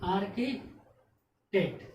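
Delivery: आर्किटेक्ट said slowly as aar-ki-tekt, h silent; drawn-out आर्किटेक्ट, no h sound; slow clear demo. Shown as a demonstration. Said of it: "जैसे".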